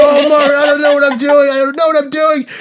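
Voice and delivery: loud, high-pitched helpless wailing; panicked wail. Retold or imitated. Man caught mopping his date's chest, panicked wail. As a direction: wail it out in full comic panic. Panicked reply to shrieking date.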